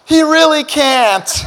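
mock worried dad. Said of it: "I'm over here going".

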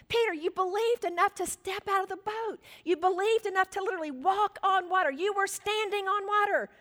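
high-pitched, insistent rebuke; rebuking. Acted. Jesus rebukes Peter for his lack of faith, rebuking. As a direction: scold in disbelief, pitched well up. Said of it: "Jesus is rebuking him".

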